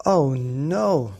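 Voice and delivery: loud groaning Daddy Bear; fed-up father bear; exasperated dad bear. Acted. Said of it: "said Daddy Bear".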